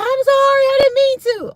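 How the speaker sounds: loud, squealing mock panic; imitated frantic apology. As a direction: wail the apology in a very high voice. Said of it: "She was freaking out".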